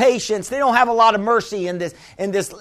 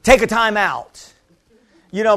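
normal talking voice

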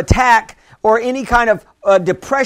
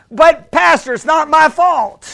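lightly imitated protester, higher and louder; mock protest; 5 semitones higher, 5 dB louder than usual. Preacher imitating a member objecting to the pastor, mock protest. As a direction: push back in a raised mock objection.

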